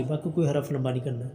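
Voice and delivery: ordinary delivery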